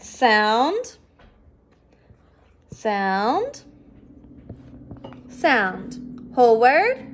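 each sound drawn out with a rising tune, then the word. Shown as a demonstration. Teacher leading the class sound by sound, rising each time. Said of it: "read these sounds with me".

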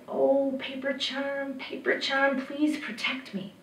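put-on boy's soft incantation; hushed plea. A narrator's reading. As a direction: beg quietly, drawing out the words. Boy pleads with the charm.

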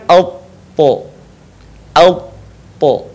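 "alpo" with a thick, heavy t. Shown as a demonstration. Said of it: "suaranya menebal".

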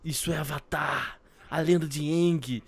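slow, lightly solemn narration; a hint of epic intro voice; mock series narrator. (narrador de abertura, de leve)

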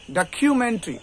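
ডকুমেন্টারি as "da-KYU-men-tri"; aspirated "khyu" and a short "tri" in ডকুমেন্টারি; correct pronunciation. Shown as a demonstration. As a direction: squeeze ডকুমেন্টারি to "dakhyumentri". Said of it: "উচ্চারণটা কি তাহলে হবে".

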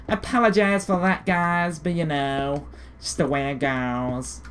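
a hint of a silly put-on voice, slow; lightly voiced goofy drawl; stupid voice. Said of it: "I do that stupid voice".

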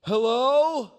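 loud, high call from far above; yelling down the shaft. Retold or imitated. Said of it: "this voice yell down the shaft".

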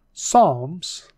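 "Psalms" with no P sound, starting on S; silent P in Psalms. Shown as a demonstration. Teacher showing the silent P in "Psalms". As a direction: drop the P: "Psalms" as sahms. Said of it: "the P is silent".

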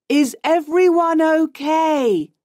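high-pitched calling out; worried shout. A narrator's reading. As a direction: raise the pitch to check on the crew. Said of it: "shouts Jack".

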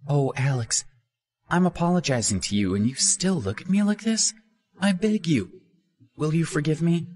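put-on high, pleading young woman; high-pitched coaxing plea; Minnie begging. A narrator's reading. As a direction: wheedle him into forgiving.

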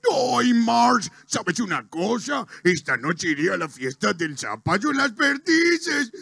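cartoon husband announcing his plans; lilting, swooping dopey cartoon voice; Homero. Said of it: "Homero llega y dice". (marido de dibujo animado, bonachón, tono cambiante)

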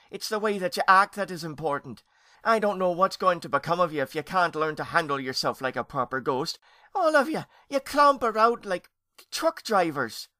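high, loud exasperated scolding; upset scolding. A narrator's reading. Aunt despairing of clumsy young ghosts, scolding.

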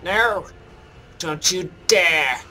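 high, loud and drawn out; slow, loud "don't you DARE"; indignant protest. Real, not acted. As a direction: cry out in indignant protest at the enemy. Protesting at enemy in game.

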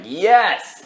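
high, loud acted cheer; triumphant cheer. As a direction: cheer it like a relieved fan. Acts out viewer's relieved cheer.

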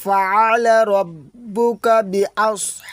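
slow, lifted, chanted verse; Quran recitation. Shown as a demonstration. Teacher recites the first verse for students. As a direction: recite slowly with held endings.

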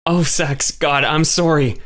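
put-on a distraught young woman begging forgiveness; upset Kay. A narrator's reading. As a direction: plead with him, slowly and high. Kay apologizing for the injury.

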